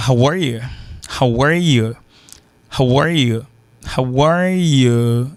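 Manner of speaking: "How are you" linked as "how-war-yuh"; reduced you. Shown as a demonstration. Teacher giving an example, "How are you" with reduced you. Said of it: "Il pas you encore".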